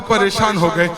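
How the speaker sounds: ordinary talk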